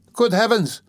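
high-pitched shocked exclamation; startled, high outcry; shocked cry. A narrator's reading. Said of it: "cried Pulcheria Alexandrovna".

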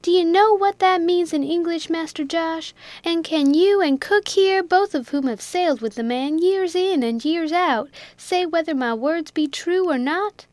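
angry Jack. A narrator's reading. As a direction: demand they judge the captain. Jack's angry challenge.

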